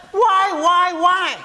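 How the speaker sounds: high, insistent string of whys; questioning child. Acted. Boys and girls asking their parents, high insistent questioning. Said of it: "would like to always ask the question".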